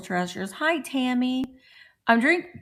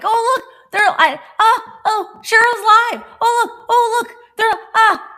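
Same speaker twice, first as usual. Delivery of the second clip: high, loud gushing squeal of joy; delighted squeal; 7 dB louder than usual. Retold or imitated. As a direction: squeal like you just spotted a friend online. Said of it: "I pop on and I'm like".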